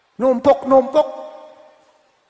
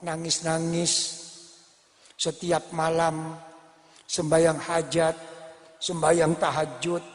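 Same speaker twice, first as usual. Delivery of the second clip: a hint of a soft, lower, pleading angel; Jibril pleading; 5 semitones lower, 3 dB quieter than usual. Angel Jibril pleads with God for a weeping man, softly and lower. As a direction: gently plead on behalf of the weeping servant. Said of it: "Matur sama Allah".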